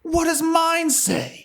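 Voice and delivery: high, slow stoner line; slow stoner quote. Retold or imitated. Quoting the movie to friends, high and slow. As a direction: drawl it out high.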